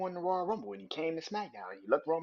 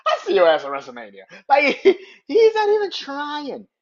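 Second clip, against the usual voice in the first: much louder, laughing hard; laughing through mock quote; 9 semitones higher, 16 dB louder than usual. Real, not acted. Fan imitating Cena's mocking reply, laughing loudly.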